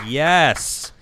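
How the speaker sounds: ordinary talk, nothing marked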